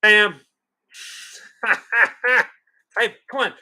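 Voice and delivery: normal talking voice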